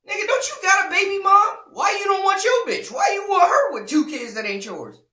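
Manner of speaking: loud, high-pitched mock-outraged yelling; heated comeback. Man firing questions back at the rival, yelling. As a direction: shout the questions in escalating disbelief.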